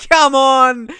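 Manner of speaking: high, loud, laughing goal celebration; ecstatic goal scream. Real, not acted. Gamer celebrating a late goal, screaming with joy. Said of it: "I sounded so weird when he got a goal".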